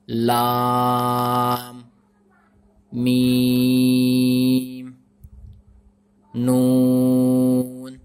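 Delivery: letters drawn out slowly; long held letter names; letter recitation. Shown as a demonstration. Teacher recites letters one by one to learners.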